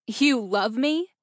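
slow, incredulous rising question; scoffing disbelief. A narrator's reading. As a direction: throw it back in disbelief, pitch climbing. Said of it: "Cora scoffed in disbelief".

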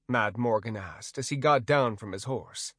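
plain reading voice

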